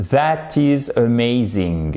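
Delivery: careful sounds with French-accented rhythm; slow, evenly stressed French-accented English. Shown as a demonstration. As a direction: pronounce each sound well but keep the French accent. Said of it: "on entend l'accent français".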